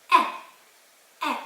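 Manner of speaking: "è" with the mouth open wide, short and clear. Shown as a demonstration. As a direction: say "è" as an open e, like in "bet". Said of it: "pronounced like an open e".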